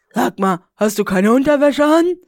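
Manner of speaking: acted question. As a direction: blurt the question out, rising in pitch and volume. Character's question acted in story.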